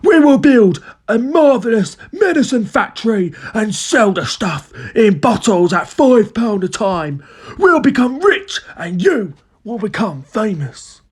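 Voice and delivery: high, theatrical scheming dad; grand excitement. A narrator's reading. Father dreaming of a medicine factory, excited. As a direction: sell the grand plan, stretching key words. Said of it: "cried Mister Kiddie Cranky".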